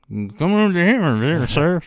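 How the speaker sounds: loud, high garbled announcement; mouth-made distorted speaker voice; unintelligible call-over. Retold or imitated. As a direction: imitate a garbled intercom call. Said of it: "don't even know what the hell he says".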